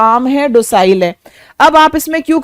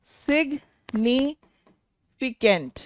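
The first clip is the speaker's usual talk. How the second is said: slow dictation; 11 dB quieter, much slower than usual. Shown as a demonstration. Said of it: "मतलब होता है significant".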